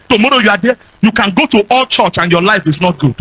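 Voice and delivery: ordinary delivery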